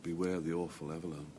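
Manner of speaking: low, soft ominous warning; mock warning. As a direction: warn them darkly, in jest. Mock warning to deciding player.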